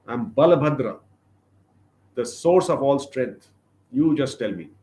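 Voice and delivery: raging elder brother demanding a name; angry demand. Acted. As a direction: thunder out who he is. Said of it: "he was very angry".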